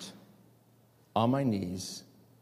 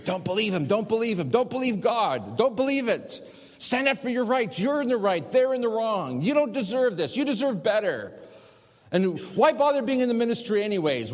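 high-pitched, insistent tempter; Satan; 10 semitones higher, 4 dB louder than usual. Retold or imitated. Preacher voices the tempter urging him to rebel, high and insistent. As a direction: urge it in a high, pressing voice, easing down at the end. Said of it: "you could just hear Satan whispering in my ear".

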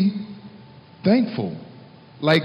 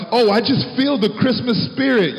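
mocking quote; 6 semitones higher than usual.

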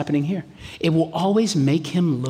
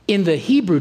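ordinary talk, nothing marked

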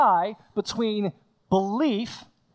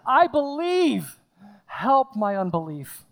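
tearful plea; 3 semitones higher, slower than usual. Retold or imitated. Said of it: "with tears".

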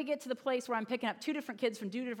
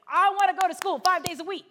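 put-on loud, high kid's outburst; mock excited yell; overeager kid; 7 semitones higher, 9 dB louder than usual. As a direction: imitate a child bursting with eagerness. Imitates overeager kid wanting school.

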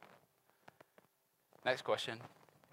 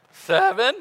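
high, loud hopeful question; eager teacher's pet; 11 semitones higher, 13 dB louder than usual. Retold or imitated. Peter hopes for a gold star from Jesus, eager. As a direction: offer the answer like a teacher's pet. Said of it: "that's how he asked it".

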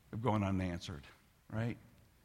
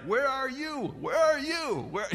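daughter on the phone; 11 semitones higher than usual. Retold or imitated. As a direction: play the confused daughter calling out. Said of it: "'Cause she's like".

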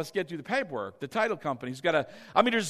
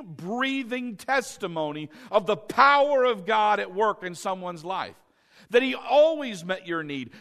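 full-force preaching; 4 semitones higher, 6 dB louder than usual. Acted. Pastor proclaiming God keeps his word to the congregation, full-force preaching. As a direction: preach it out with rising fervor.

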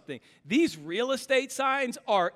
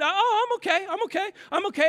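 put-on much higher little boy saying he's fine; child reassuring; 13 semitones higher, 4 dB louder, faster than usual. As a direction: chirp it like a dazed little kid. Son voiced in story.

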